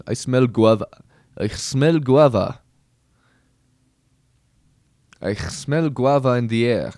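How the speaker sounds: a Russian soldier shouting that he smells guava. Retold or imitated. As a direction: bellow it in a bad Russian accent. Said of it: "I can't do Russian".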